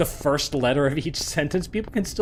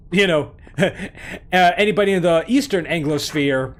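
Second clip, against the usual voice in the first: ordinary delivery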